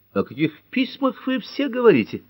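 high, feigned bewildered question; mock innocent. Ellery feigns ignorance to the police, high mock bewilderment. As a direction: play dumb, pitched well up.

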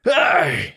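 loud, high cry of pain; sudden shouted ouch; pained yell. A narrator's reading. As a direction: yelp as if bitten. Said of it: "заорал Перин".

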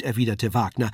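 usual speaking voice

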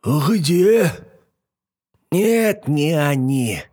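put-on high old hag voice; fussy old witch peering. Yaga's voice.

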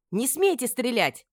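high, slow forceful cry; shouted order. A narrator's reading. Mistress forbids guards to shoot, shouting. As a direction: cry out to stop them. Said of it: "крикнула".

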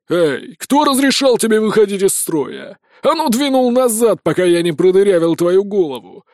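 loud, high angry command; snarling yell. A narrator's reading. Sheriff yelling at the prisoner who broke ranks. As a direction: bark him back into line. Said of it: "рявкнул шериф".

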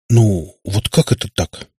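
slow, lost plea; a mildly bewildered father seeking support. A narrator's reading. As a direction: ask it helplessly, at a loss. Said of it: "растерянно посмотрел".

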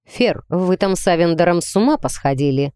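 plain reading voice

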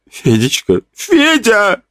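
put-on high, crying old mother; sobbing. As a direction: cry out her son's name. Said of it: "рыдала пожилая женщина".

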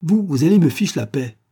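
loud, high, exasperated order; angry rebuff. A narrator's reading. Policeman silencing the protesting prisoner, angrily. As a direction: shut him up sharply.

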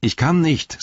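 high, helpless call; verzweifeltes Zurückrufen. A narrator's reading. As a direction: call up to your companion, stuck. Frodo calls up from below. (hoch und hilflos gerufen)

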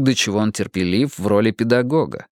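ordinary delivery, nothing marked

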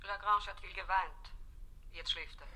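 soft, high voice down the line; distant phone voice; voice on the telephone. Acted. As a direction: report quietly through the receiver. Nurse reports over the phone.

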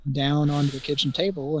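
usual speaking voice

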